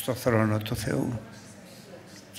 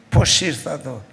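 ordinary talk

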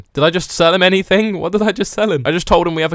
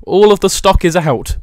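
ordinary delivery

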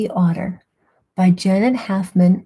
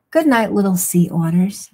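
plain reading voice

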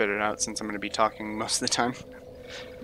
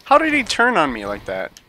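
loud, high, disbelieving yell; shocked outburst; 12 semitones higher, 8 dB louder than usual. Real, not acted. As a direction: shout it in total disbelief. Gets killed unexpectedly in PVP.

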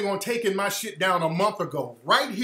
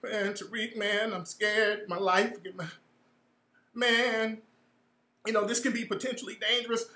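mock scared whimper; 9 dB quieter than usual. Mocking the caller's fear on the phone, soft and scared. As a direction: play him timid and shaken, mocking. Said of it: "sounding like a bitch who just had a miscarriage".

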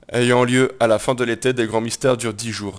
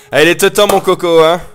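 loud, high gleeful cry; excited cheer; 6 semitones higher, 11 dB louder than usual. Real, not acted. Gamer cheering as war is finally declared, loud and gleeful. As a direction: crow it out, finally it's happening.

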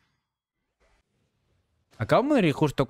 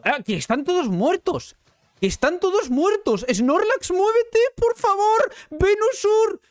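panicking gamer; slower than usual. Real, not acted. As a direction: plead in frantic desperation. Whole team dead, pleading.

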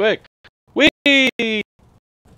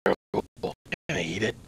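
low quiet raspy ghoul; hungry rasping ghoul; 7 semitones lower, 10 dB quieter, much faster than usual. Acted. Plays a ghoul character.

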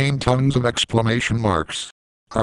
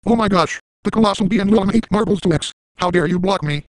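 much higher, fast chipmunk-like robot voice; high sped-up Sam voice; 9 semitones higher than usual. Pretend. Squeaky high voice mocking a grounded threat maker. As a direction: play a squeaky sped-up text voice yelling threats. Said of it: "using Sam's voice in a high pitch".

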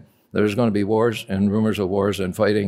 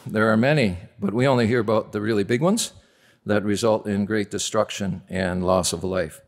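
normal talking voice

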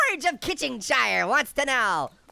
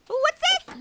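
high curious. Real, not acted. Finding item in game.

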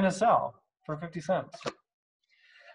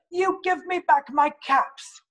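much higher, put-on angry peddler; scolding the monkeys; 11 semitones higher than usual. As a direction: scold them with a raised fist. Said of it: "shaking a fist at them".

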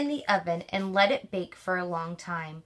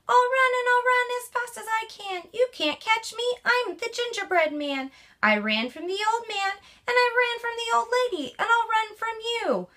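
high, boastful gingerbread man taunting; high cheeky runaway cookie; cocky gingerbread man; 12 semitones higher than usual. Acted. Gingerbread man voiced in story.

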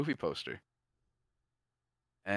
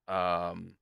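ordinary talk, nothing marked

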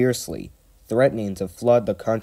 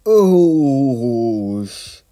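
long, loud, high whoosh; drawn-out falling "oosh"; hissing steam; 5 semitones higher, 7 dB louder than usual. A narrator's reading. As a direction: imitate water drenching a fire, sliding down to a hiss. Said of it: "Then it sloshed his fire".

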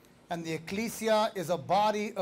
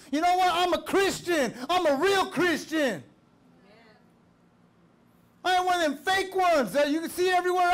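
raised, high-pitched preaching; żarliwe kazanie; 7 semitones higher than usual. Real, not acted. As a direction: preach it forcefully, driving each phrase home. Preaches at full force.

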